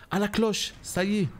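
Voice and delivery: voix qui monte, joyeuse; s'exclamer avec joie en voyant la cloche; excité. Real, not acted. Trouve la cloche du jeu. (cri de joie)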